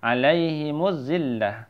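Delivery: "zillah" ending in an audible h; waqaf stop. Shown as a demonstration. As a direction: recite "Alaihimuz zillah", stopping on the h. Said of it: "Ta-nya menjadi ha".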